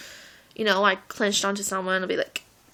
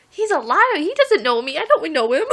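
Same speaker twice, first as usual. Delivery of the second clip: indignant denial; 6 semitones higher, 3 dB louder than usual. Retold or imitated. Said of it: "I'm like".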